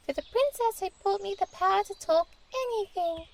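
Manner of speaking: the mouse pledges to help the princess, a lightly voiced mouse.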